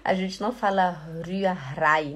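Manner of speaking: usual speaking voice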